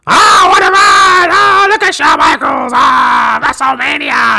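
screaming, very high announcer hype; Vince McMahon. Retold or imitated. Podcaster shows how the nineties commentary wrecked the voice, screaming. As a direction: strain the voice in frantic, drawn-out bellows. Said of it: "It's because of this stuff he would do".

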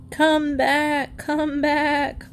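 high, drawn-out duck call. A narrator's reading. Duck couple wanting the girl back, calling. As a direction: call it high, as if across a farm. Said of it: "called Martha and Millard Mallard".

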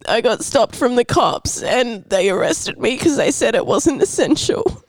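high, distressed fake arrest call; upset. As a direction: act panicked and near tears. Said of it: "I'm gonna need your best acting".

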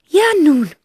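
high aunt voice, sighing. A narrator's reading. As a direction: sigh it like the aunt.

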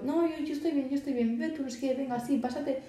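lightly voiced teen turning down a drink kindly; breezy brush-off. Shown as a demonstration. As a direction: cheerfully wave off the drink and push it back. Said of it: "Tú, pero".

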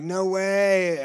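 mock the nurses' amazement; amazed gasp.

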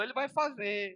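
high-pitched, lightly mimicked weeping; imitated tearful father. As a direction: insist like a father in tears, only imitated. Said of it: "meu pai chorando falou". (imitação leve de choro)

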